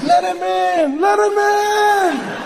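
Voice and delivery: high, loud, stretched-out cry; frantic crowd cry. Acted. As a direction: urgently call out, high and loud. Awed crowd answers Mandela's knock.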